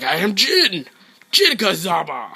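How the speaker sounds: mock-heroic fighter cry; quoting opponent as Jin. Gamer mocking his opponent, hammed-up fighter cry. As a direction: announce yourself like the game character. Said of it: "So he was like".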